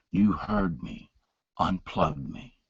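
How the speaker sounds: a weak man pressing his point; faint insistence. Retold or imitated. Dying father insisting.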